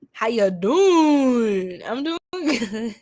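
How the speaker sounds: slow, lightly put-on New York drawl; a hint of a New York accent, stretched out; New York accent imitation. Streamer imitating how a viewer hears her New York accent. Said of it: "is that what I sound like to you".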